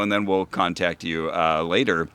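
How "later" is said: ordinary delivery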